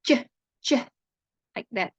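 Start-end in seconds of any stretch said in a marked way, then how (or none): 0.1-0.7 "ch" as a short sharp voiceless burst; ch sound. Shown as a demonstration. Teacher showing students the ch sound, short bursts. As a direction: snap out "ch" like the start of chair. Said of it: "the symbol is pronounced".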